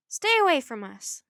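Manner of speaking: loud, forceful warning; defiant warning. A narrator's reading. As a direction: warn them off loudly. Child warns villains off.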